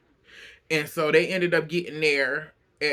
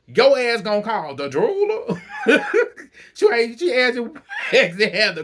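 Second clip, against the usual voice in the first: talking through laughter; words breaking into giggles; laughing; 4 semitones higher, 4 dB louder than usual. Real, not acted. Laughing through the story to the listener.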